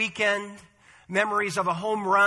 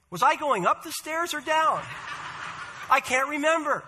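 put-on confused old lady; 7 semitones higher than usual. Confused old sister in a joke.

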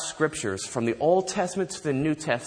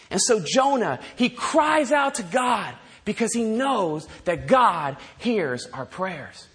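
ordinary talk, nothing marked

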